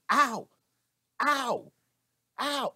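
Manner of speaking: high loud mock yelps of pain. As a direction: yelp like you touched a hot stove. Mimicking hand on hot oven.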